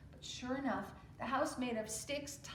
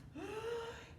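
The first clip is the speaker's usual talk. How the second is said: sound; 12 semitones higher, 5 dB quieter than usual. Acted. Wolf drawing breath.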